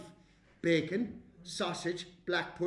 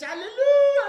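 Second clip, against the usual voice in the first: exultant outburst; 14 semitones higher, 15 dB louder than usual. Acted. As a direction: belt it out and hold the note. Child bursts out amid garbled lesson.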